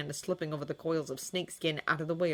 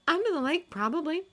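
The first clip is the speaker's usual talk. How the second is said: put-on girl voice; 13 semitones higher, 5 dB louder than usual. A narrator's reading. Julia voiced high.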